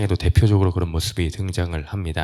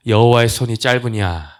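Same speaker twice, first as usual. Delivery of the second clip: loud, higher-pitched divine rebuke; raised rhetorical challenge; 4 semitones higher, 5 dB louder than usual. Acted. 하나님의 말씀을 높여 인용. (목소리를 높여 꾸짖듯 되묻기)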